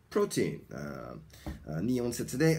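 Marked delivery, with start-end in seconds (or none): 0.1-0.6 put-on "Protein" as PRO-teen, PRO higher and stronger; two clear syllables in "Protein", the first bigger; stress on first syllable. Shown as a demonstration. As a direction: hit PRO in "Protein", let "teen" fall. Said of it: "最初の音節の方が大きいんですよ".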